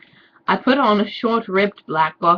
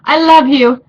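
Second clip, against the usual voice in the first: mock lovesick; 6 semitones higher, 9 dB louder than usual. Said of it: "I said".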